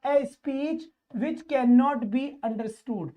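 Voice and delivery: very slow, word by word reading; deliberate teacher dictation pace. Shown as a demonstration. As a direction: spell the question out slowly for the class. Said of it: "reads question".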